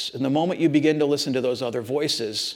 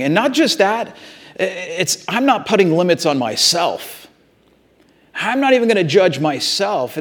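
loud, building sermon delivery; preaching at full force; 6 dB louder than usual. Acted. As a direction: drive Paul's words home from the pulpit. Preaches Paul's words at full force.

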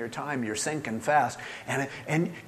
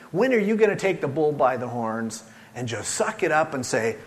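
usual speaking voice

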